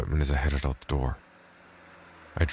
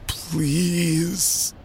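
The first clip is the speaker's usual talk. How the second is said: high, desperate begging plea; pleading beggar; 13 semitones higher than usual. Acted. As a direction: beg with everything you have. He begged some more.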